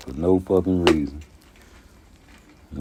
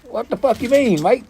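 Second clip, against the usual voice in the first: Australian impression. Australian visitor lost in Chicago greeting locals, hammed-up Australian accent. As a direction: ham up an Australian tourist in Chicago, pitched high. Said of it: "from Australia".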